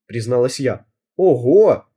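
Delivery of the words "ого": loud, surprised exclamation. A narrator's reading. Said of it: "удивилась Тоня". (удивлённо и громко)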